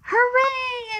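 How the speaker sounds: high, loud cheer. Acted. Reader cheering for the letter I. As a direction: burst out with a happy hooray.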